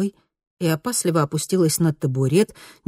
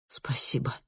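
soft, heartfelt thanks; 3 semitones lower, 8 dB quieter than usual. A narrator's reading. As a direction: thank her with feeling. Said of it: "с чувством сказала она".

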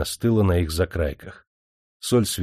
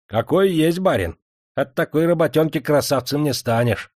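angry retort; 6 semitones higher than usual. A narrator's reading. Salt worker answers the master's mockery, angry. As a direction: snap back at the master. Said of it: "сердито сказал соливар".

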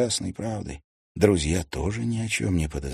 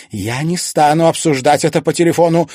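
loud, higher-pitched firm refusal; curt refusal; 9 semitones higher, 10 dB louder than usual. A narrator's reading. Mother refusing to discuss it by phone, curt. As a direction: cut the discussion off at once. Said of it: "мгновенно отрезала Ангелина".

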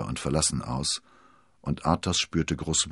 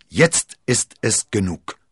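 louder, slowed, forceful shout; 5 semitones higher, 8 dB louder, slower than usual. A narrator's reading. Athos to his friends after smashing the glass, furious shout. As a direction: hurl the words out in fury. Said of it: "schrie er".

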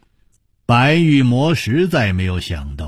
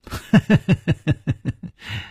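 high cackle; 6 semitones higher than usual. Acted. Villain laughs before retort.

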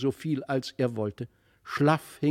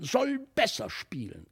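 higher-pitched, petulant repetition; insistent demand; 7 semitones higher than usual. A narrator's reading. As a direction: insist like a frustrated boy. Felix insists, repeating his demand.

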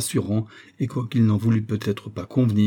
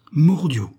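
higher, louder exclamation; exclaimed oath; 6 semitones higher, 6 dB louder than usual. A narrator's reading. Captain reacting to sighting of a stranger on the rock, exclaiming. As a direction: burst out with a narrated oath. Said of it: "s'écria le capitaine Servadac".

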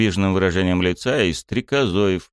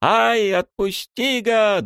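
very high pained squeal; much slower than usual. A narrator's reading. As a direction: squeal at the biting hare. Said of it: "взвизгнула Юки". (взвизгнула от боли)

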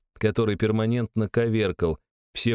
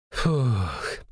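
smoky exhale; 3 semitones higher than usual. A narrator's reading. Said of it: "выдохнула она сизый дымок".

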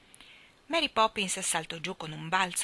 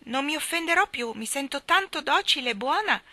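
put-on meek little girl; 8 semitones higher, 4 dB louder than usual. A narrator's reading. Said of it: "disse Jane".

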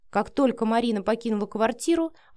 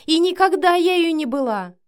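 high, loud, offended protest; indignant outburst; 9 semitones higher, 8 dB louder than usual. A narrator's reading. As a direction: keep protesting with the voice raised. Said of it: "выпалила Вика". (возмущённо, на повышенных тонах)

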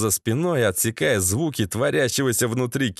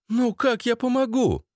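high-pitched, puzzled female voice; surprised woman; 11 semitones higher than usual. A narrator's reading. Lena is told to help Dima, high puzzled question. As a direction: ask it baffled, pitched way up. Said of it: "удивилась Лена".

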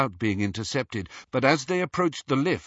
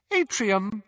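cool lift announcement voice; detached recorded witch voice; cool announcer; 10 semitones higher than usual. A narrator's reading. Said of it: "the witch's cool voice".

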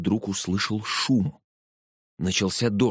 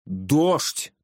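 high, excited exclamation; raised, eager cry; 7 semitones higher, much slower than usual. A narrator's reading. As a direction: burst out with the answer. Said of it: "хором выкрикнули пассажиры".

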